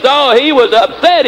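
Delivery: ordinary talk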